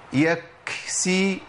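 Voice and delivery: يكسي very slow with the k shut hard; wrong stopped k. Shown as a demonstration. Teacher showing a forbidden pronunciation. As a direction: clamp the k of يكسي, no breath. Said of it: "ما أقولش يكسي".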